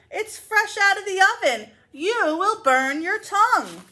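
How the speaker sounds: put-on high, loud old lady warning; fussy grandmother. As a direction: warn like a fretting granny.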